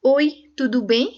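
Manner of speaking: "oi, tudo bem?" said slowly with a rising ask; slow greeting demo. Shown as a demonstration. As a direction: model the casual greeting slowly. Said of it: "जैसे suppose के कहा".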